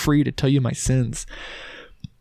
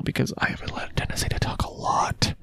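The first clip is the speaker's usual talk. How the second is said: soft breathy whisper, half to himself; whispered aside; 7 dB quieter than usual. Real, not acted. Podcaster owning up to talking too much, whispered aside. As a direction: confess it under your breath.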